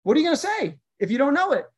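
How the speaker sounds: playful challenge. Pretend. Teasing kids with a challenge.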